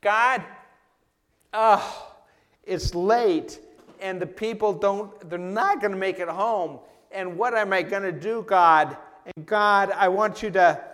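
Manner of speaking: high, loud, pleading cry to God; frantic prayer. Retold or imitated. Pastor acting his own desperate prayer, loud and high. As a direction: beg God in near panic. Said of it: "This might have been my prayer".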